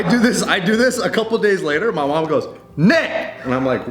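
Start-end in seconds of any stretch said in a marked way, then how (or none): 2.8-3.3 mom calling out. Retold or imitated. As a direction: imitate an angry mom yelling for her kid. Mom angrily calls her son.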